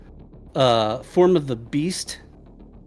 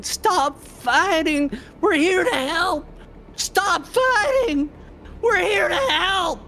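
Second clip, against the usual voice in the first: high, slow, sobbing shout of panic; panicked crying yell. Acted. Gok begs the fighters to stop, crying in high panic. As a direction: yell through tears, begging them to stop. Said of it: "Tears streaming out of his face as he's yelling".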